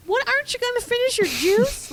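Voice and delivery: high, loud mock-mom question; nagging mom. Mom to son rushing out, mock nagging. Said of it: "such a mom thing to be like".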